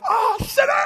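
very high, loud, hammed-up accusation; mock condemning shout. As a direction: shriek it like a scandalized zealot. Mock condemnation of eating fast food.

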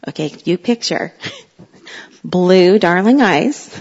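ordinary talk

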